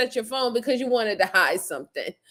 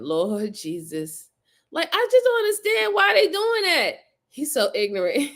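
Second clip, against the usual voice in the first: usual speaking voice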